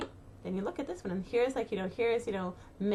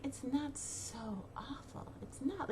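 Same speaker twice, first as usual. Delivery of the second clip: much softer, slow and soothing; soft reassurance; 2 semitones higher, 9 dB quieter, slower than usual. Real, not acted. Reassuring listeners after the mock panic, softly. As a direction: gently talk them down.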